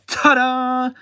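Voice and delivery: high, drawn-out reveal; showy fanfare. Pretend. Host unveiling Mary Jane, showy high fanfare.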